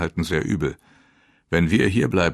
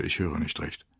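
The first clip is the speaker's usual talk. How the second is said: ordinary delivery, nothing marked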